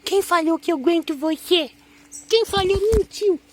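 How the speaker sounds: high, loud squeal to a puppy; pet baby talk. Pretend. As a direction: coo excitedly in a high baby voice. Falando de brincadeira com cachorro. (voz aguda e alta de quem mima cachorro)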